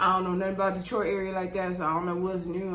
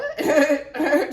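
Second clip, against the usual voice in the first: a sudden loud laugh, rising high; burst of laughter; 7 semitones higher, 7 dB louder than usual. Real, not acted. Vlogger cracking up at the preacher news, real laughter.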